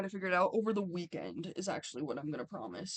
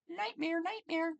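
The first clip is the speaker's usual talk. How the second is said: dramatic high exclamation; 9 semitones higher, slower than usual. Acted. Reads with high emphasis.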